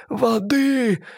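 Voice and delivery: put-on high, childlike babble; babbling mermaid plea. A narrator's reading. Mermaid babbling to her captor for fish. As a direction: babble it like a helpless sea creature.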